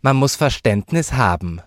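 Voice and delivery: loud, high feigned virtue; hypocritical. A narrator's reading. Child needling her uncle, loudly hypocritical. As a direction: pipe up with false piety. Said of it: "fügte Sazie heuchlerisch hinzu".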